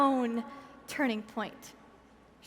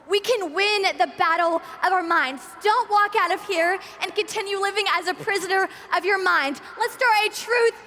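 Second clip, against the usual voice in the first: rousing shout; 4 semitones higher, 4 dB louder than usual. Real, not acted. Rousing close of speech.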